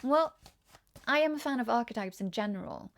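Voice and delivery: ordinary delivery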